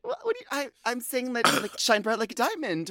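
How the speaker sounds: high, fumbling imitation of Ru; flustered Ru. Host put on the spot, high flustered stammer. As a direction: stammer like a caught-off-guard host. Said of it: "Ru was like".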